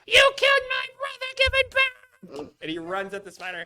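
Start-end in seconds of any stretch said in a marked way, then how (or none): 0.1-2.5 very high, loud, screeching goblin fury; high-pitched goblin battle scream; furious goblin shriek. Acted. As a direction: shriek it like an enraged little goblin. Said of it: "and he goes".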